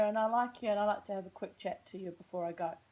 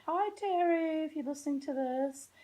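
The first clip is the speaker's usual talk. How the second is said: gushing greeting; 9 semitones higher, 5 dB louder than usual. Real, not acted. Greeting friend Terry.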